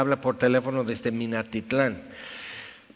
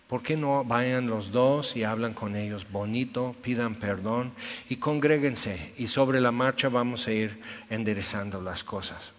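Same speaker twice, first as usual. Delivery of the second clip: gentle pleading; 4 semitones lower, 7 dB quieter than usual. Retold or imitated. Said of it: "yo hablo bien bonito".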